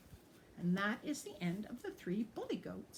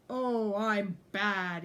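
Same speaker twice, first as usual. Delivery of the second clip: slow, louder, relishing gloat; gloating exclamation; 7 dB louder, much slower than usual. A narrator's reading. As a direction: savour being bad. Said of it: "he exclaimed".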